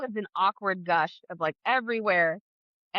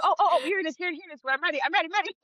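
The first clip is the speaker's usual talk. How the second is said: urgent excitement; 8 semitones higher, much faster than usual. Retold or imitated. Contraction finally arrives, urgent.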